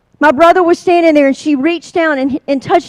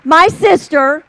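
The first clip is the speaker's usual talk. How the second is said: ordinary talk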